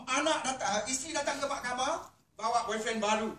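loud outraged declaration; indignant. Acted. Preacher denouncing the wife's new boyfriend, loud. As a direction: thunder it out.

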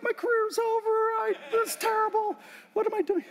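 high, strained wail; panicked despair. Retold or imitated. Speaker answering the cheering fans, panicked despair. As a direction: moan it like the end has come. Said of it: "I'm like".

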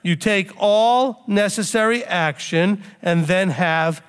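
slow, deliberate restatement with pauses; measured and slow, letting "all necessary action" land; emphatic restatement. Real, not acted. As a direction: spell it out slowly, phrase by phrase. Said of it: "Let me say that again".

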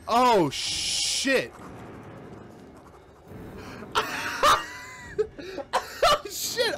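high shrieking laugh and cries; panicked laughter. Real, not acted. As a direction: laugh out in fright. Continued panic.

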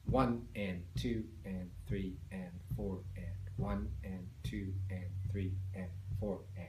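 low, soft metronomic counting, slowed down; steady beat count. Shown as a demonstration. Teacher counting downbeats and upbeats for foot tapping, soft steady count. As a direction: count evenly and slowly, voice dropped low. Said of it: "count the beat like this".

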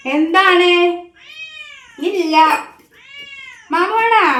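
pet talk. Real, not acted. Talking to the cat. (പൂച്ചയോട് ഉച്ചത്തിലുള്ള കൊഞ്ചൽ)

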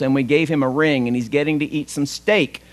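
normal talking voice

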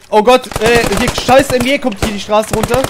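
high, loud alarmed shout. Real, not acted. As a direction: yell the alarm. Machine gun coming down street.

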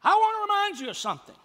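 high, fast, loud outburst; urgent high-pitched cry to demonstrate emphasis; emphatic exclamation. Shown as a demonstration. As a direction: exclaim it at the top of your range. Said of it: "Like our exclamation. Emphasis".

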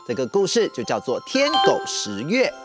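plain reading voice

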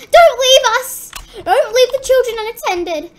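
loud, high cry of alarm; playful high-pitched panic; panicked plea. Pretend. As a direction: call out desperately after someone leaving. Scared, begging not to be left.

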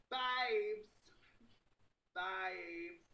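exaggerated British accent, drawn out. Streamer showing viewers her "real voice", playful British accent. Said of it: "start talking in a British accent".

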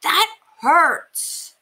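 wounded voice; hurt well. A narrator's reading. Well complaining to the mouse, pained. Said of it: "said the wishing well".

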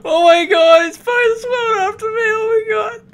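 high, loud frantic wailing; panicked. Real, not acted. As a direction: cry it out in real panic. Fleeing a chasing swimmer.

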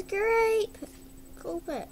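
ordinary delivery

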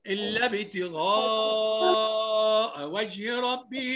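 slow tajweed chant; Quran recitation. Acted. Teacher reciting to the class, slow recitation. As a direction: stretch the madd in a slow chant.

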